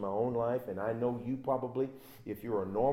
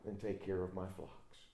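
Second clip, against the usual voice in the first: much softer, lower answer; soft reply; 5 semitones lower, 10 dB quieter than usual. Acted. Jesus to Peter, gently after his tears, soft and low. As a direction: hush after the storm.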